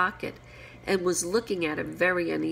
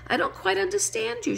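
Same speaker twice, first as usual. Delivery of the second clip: high-pitched, careful politeness; very polite little high voice. A narrator's reading. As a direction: pitch it right up, as politely as you can. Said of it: "as politely as she could".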